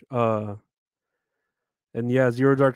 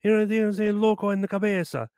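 higher-pitched mock Cuban accent, scolding; Ricky scolding Lucy; 9 semitones higher than usual. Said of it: "he would go".

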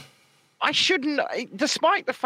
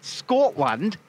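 exaggerated Scottish accent, each word set apart; mock Scottish accent; much slower than usual. Host giving a lesson in talking like John, mock Scottish accent. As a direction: mimic a Scot, word by deliberate word. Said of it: "say words like John".

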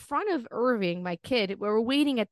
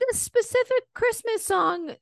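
put-on much higher, slow pleading kid voice; whiny kid imitation; 4 dB louder, slower than usual. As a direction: beg like a demanding child. Kids demanding a song, imitated.

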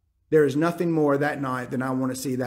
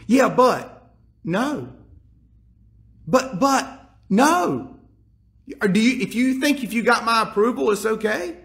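higher, louder mock argument; mock back-and-forth; 6 semitones higher, 3 dB louder than usual. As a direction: play both sides of the argument, higher. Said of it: "And he'll go".

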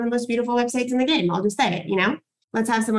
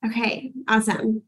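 ordinary delivery

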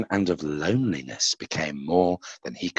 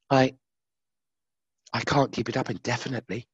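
quiet whisper; 3 semitones higher, 6 dB quieter than usual. A narrator's reading. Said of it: "whispered Lucifer quietly".

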